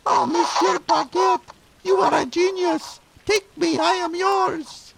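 very high squeaky femme fatale; falsetto lady voice. Acted.

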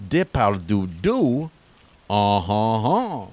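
put-on hammy French detective accent; slow, smug French sleuth accent.

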